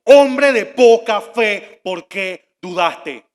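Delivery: very loud, slow, word-by-word reprimand; stern rebuke. Acted. Jesus reproving doubting Peter, loud slow rebuke. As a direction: thunder it slowly, easing slightly on the question. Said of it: "lo reprendió y le dijo".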